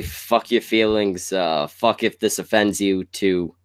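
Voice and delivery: usual speaking voice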